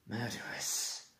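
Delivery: a slow, soft hiss of a word. A narrator's reading. Dragon answering her rider, a slow hiss. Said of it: "she hissed".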